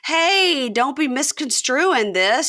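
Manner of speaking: hammed-up boyfriend excuse; mock defensive Chance. Chance to his crying girlfriend, mock pleading. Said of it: "And Chance goes".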